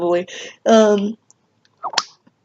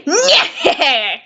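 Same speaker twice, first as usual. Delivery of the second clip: nasal "nyeh-heh-heh" laugh; character cackle. Acted. Ending the video for viewers, nasal character cackle. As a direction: cackle like a cartoon villain signing off. Signature Papyrus sign-off laugh.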